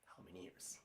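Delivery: barely audible whisper to oneself; whispered aside. Real, not acted. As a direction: murmur it privately while trying to remember. Aside to himself while recalling a number.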